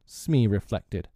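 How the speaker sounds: plain reading voice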